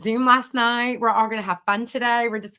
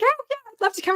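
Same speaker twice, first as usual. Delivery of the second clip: much higher, bright and eager; eager reply; 14 semitones higher, faster than usual. Retold or imitated. Retelling her reply to the client, eager and much higher. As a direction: squeal yes to the invitation.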